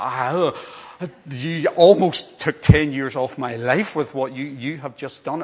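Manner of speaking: loud, slow exasperated scolding; exasperated parent. Retold or imitated. Parent scolding child found after scare, loud exasperation. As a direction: let it out, slowly, ten years off my life. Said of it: "you just thought".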